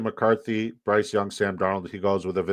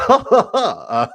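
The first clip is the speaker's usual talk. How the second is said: laughing; 10 semitones higher, 10 dB louder than usual. Real, not acted. Laughing at own impression.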